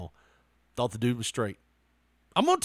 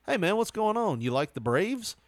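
casual buddy talk; 5 semitones higher than usual. Shown as a demonstration. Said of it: "different from you going".

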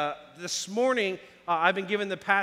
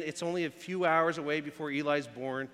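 normal talking voice

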